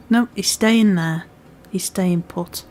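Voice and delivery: normal talking voice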